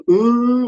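high, drawn-out "äh"; mock sluggish groan. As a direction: imitate someone slouching around with a long whine. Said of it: "mit seiner Körperhaltung so". (gespieltes, gequältes Stöhnen)

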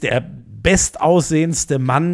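drawn-out compliment. Acted.

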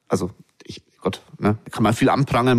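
ordinary talk

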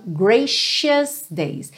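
"gracious days" as "GREY-shus days", sh sound, slowly. Shown as a demonstration. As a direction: stress GRA and use sh in "gracious". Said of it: "Esse C vai ter um som de X ou de S H". ("gracious" com som de x, devagar)

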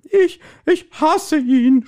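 high, slow sobbing stammer; hammed-up sobbing, voice way up. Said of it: "schluchzte sie".

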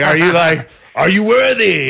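a laughing sendup of a judgmental crowd; snooty judging. Retold or imitated. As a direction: play the haughty critic with a laugh. Mocking judgy French crowds.

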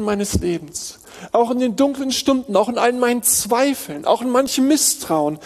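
rising, urgent sermon voice; fervent preaching. Acted. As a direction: press the point home with rising fervour. Preaching continues raised.

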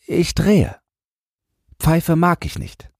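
put-on high, mildly timid child's voice; timid boy. A narrator's reading. Boy answers the man about smoking, timid. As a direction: answer shyly as a little boy. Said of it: "sagte zaghaft".

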